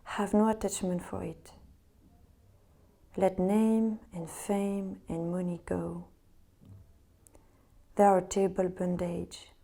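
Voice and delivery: meditative reading in French-accented English. Real, not acted. Yoga teacher reading Vivekananda to resting students, French-accented English. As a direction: read it calmly with a French accent, pausing between phrases. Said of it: "Je m'excuse d'avance pour mon accent".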